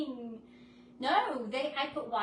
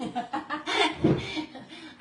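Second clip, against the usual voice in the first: high laugh; 4 semitones higher, 9 dB louder than usual. Real, not acted. After voicing the gnome.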